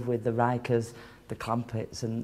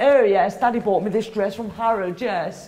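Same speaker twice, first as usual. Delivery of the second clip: loud, higher-pitched mock boasting; mimicking boastful woman; 6 semitones higher, 5 dB louder than usual. Woman bragging to the queue about her dress, loud mocking imitation. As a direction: show off loudly, as a mocked daddy's girl.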